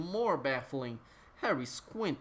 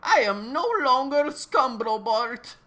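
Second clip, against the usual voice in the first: much higher, loud and booming villain declaring himself; 9 semitones higher, 8 dB louder than usual. Acted. Villain announcing his new name, in a high booming character voice. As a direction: proclaim it like a ranting cosmic villain.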